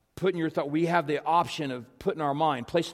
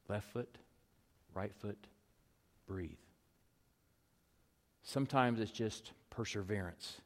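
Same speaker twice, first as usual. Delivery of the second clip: very soft, low, with long pauses; hushed pastoral reflection; 5 semitones lower, 10 dB quieter than usual. Acted. Preacher leads congregation through a coach's mantra and breath, hushed. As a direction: guide them gently, pausing after each short phrase.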